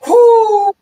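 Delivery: high, loud whoo; excited whoop. Real, not acted. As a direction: cheer wildly. Kickoff excitement.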